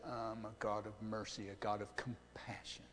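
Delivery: low, very soft quote of God; softly voiced words of mercy; hushed divine quote. Quoting God describing himself as merciful.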